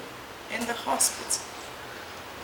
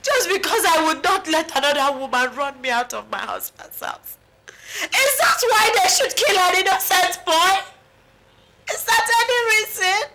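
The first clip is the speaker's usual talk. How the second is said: anguished wailing; 2 semitones higher than usual. Acted. Bereaved mother asking why they killed an innocent boy, wailing. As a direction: wail it out, demanding an answer.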